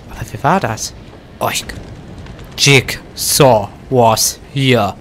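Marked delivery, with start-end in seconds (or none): 2.6-4.9 reading the wall writing very slowly. Real, not acted. Streamer reading a scrawled horror message slowly. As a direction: drag the words out, ominous.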